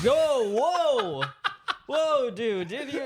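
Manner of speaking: loud, high, stretched "whoa"; acted alarm. Friends see hand stuck in fire, mock alarm. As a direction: shout to stop a friend, hammed up.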